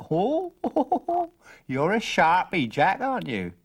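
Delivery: high gleeful cackle, then sly praise; amused old stranger. Acted. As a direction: laugh it off like a crafty old man.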